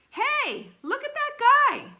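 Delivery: put-on sudden shout spotting the running boy; excited shout. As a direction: yell to get everyone to look. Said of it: "Roy wanted to shout".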